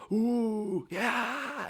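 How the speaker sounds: very high, loud "Ooh, yeah"; crowd cheering. Acted. GM voicing the crowd cheering the fire trick, high and loud. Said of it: "everyone's like".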